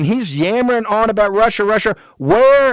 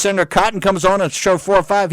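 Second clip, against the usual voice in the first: normal talking voice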